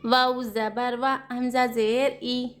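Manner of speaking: slow, drawn-out sing-song letter spelling; slow spelling drill. Shown as a demonstration. Teacher spelling out Quranic words for students, slow sing-song chant. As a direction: chant each letter and vowel, then the joined syllables.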